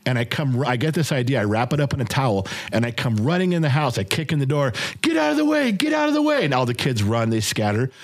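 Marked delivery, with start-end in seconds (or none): 5.0-6.4 high urgent yell, get out of the way; shouting to clear the way. Retold or imitated. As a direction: bark it at scattering kids, pitch way up. Dad bursting in shouting at kids.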